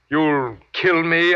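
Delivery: hopefully. Acted. Captive asks his alien jailer about death, dryly hopeful. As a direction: ask as if being killed would be welcome news. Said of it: "I ask hopefully?".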